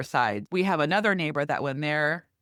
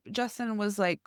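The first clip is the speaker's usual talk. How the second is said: normal talking voice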